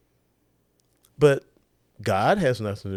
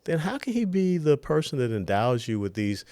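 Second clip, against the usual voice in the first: ordinary delivery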